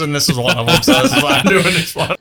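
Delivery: folk hero voice, laughing; southern accent. Acted. Druid laughing about her few voices, southern accent. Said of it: "your version of folk hero voice".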